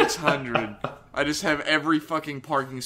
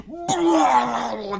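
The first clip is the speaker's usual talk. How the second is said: loud high blah like something blowing up; mock explosion noise; 11 semitones higher, 4 dB louder than usual. Mocking Emu bursting into effects, loud blast noise. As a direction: blurt a comic explosion sound.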